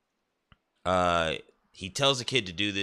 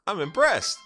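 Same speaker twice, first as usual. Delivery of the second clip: much higher, louder sarcastic praise; mock impressed; 13 semitones higher, 6 dB louder than usual. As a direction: overplay the admiration, way up high. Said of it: "He goes".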